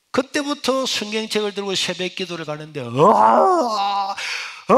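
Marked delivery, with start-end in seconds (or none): 2.8-4.8 long drawn-out wailing sobs, loud; loud wailing cry. Retold or imitated. Preacher retelling walking to dawn prayer, loud wailing cry. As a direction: wail it out like a boy overcome on the road. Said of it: "하고 울면서".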